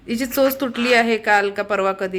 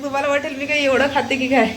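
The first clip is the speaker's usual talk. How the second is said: normal talking voice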